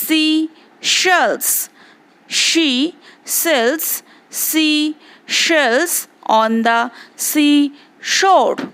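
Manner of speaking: tongue twister spoken slowly and evenly; slow demo. Shown as a demonstration. Teacher demonstrates the tongue twister slowly for the class. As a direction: space the words out so pupils hear every sound. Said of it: "सुनो और एक बार सुन लो ध्यान से".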